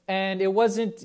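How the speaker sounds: ordinary delivery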